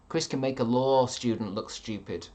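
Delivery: ordinary talk, nothing marked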